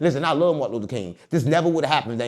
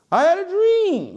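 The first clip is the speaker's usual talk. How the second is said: high, slow, loud preacher line; mock MLK orator; 4 dB louder, slower than usual. Lightly voicing Martin Luther King's speech for the host. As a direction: boom it out like a civil rights sermon.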